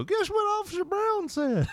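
very high, squeaky mock-kid voice; gossiping kid. Kids gleefully passing on gossip.

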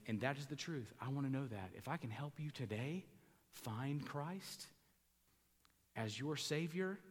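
ordinary talk

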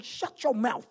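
high-pitched scolding order; retold telling-off. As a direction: tell off rowdy youths, acted out. Retold telling-off of swearing youths.

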